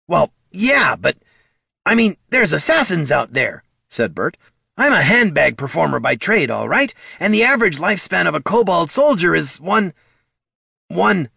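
high, loud, wriggling excuses; nervous kobold. Acted. Kobold making excuses to the troll about the assassins. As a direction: talk your way out of danger. Kobold balks at guard duty.

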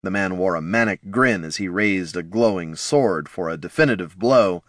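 plain reading voice

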